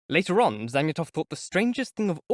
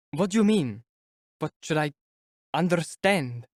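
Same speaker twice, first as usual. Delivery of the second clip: near panic; 3 semitones higher than usual. A narrator's reading. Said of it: "almost in a state of panic".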